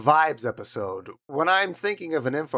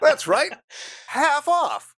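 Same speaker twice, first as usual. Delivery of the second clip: high, bright bargain call; home shopping host; 12 semitones higher, slower than usual. Pretend. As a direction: sell the discount. Mock infomercial pitch.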